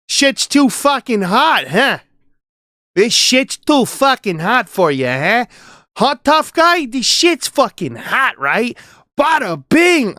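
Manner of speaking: loud, high New York tough-guy act; hammed-up New York accent, punchy and high. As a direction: heckle like a cocky New Yorker. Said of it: "It's like New York accent".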